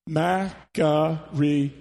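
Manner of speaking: makarioi drawn out slowly, each syllable clear; said together slowly. Shown as a demonstration. Pastor leading the congregation in saying the Greek word, slow. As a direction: lead the room through makarioi slowly. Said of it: "let's all say this word together".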